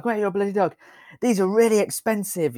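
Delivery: angry farmer. Retold or imitated. Redoing the farmer's shout after being asked, hammed-up yell. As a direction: ham up the farmer bellowing at them. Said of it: "this was very loud".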